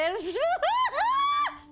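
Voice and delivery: high, loud burst of laughter; shrieking laugh. Real, not acted. Heated talk breaks into laughter.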